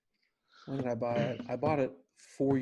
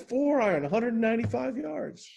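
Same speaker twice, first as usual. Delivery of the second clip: high, louder, laughing as he says it; giddy shout; 11 semitones higher, 4 dB louder than usual. Retold or imitated. Friend shouting his club and yardage, giddy and high. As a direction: crow the yardage, barely containing it. Excited drunk friend telling shot.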